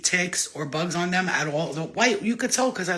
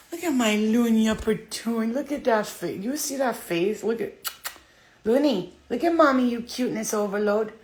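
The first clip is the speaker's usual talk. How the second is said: higher cooing pet voice; 5 semitones higher than usual. Real, not acted. Cooing over her cat.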